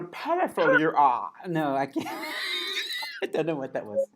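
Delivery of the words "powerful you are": slow, croaky little alien sage; a bungled puppet-sage voice; drawn-out and strained, like a small creature; Yoda. Pretend. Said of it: "I've tried to do Yoda".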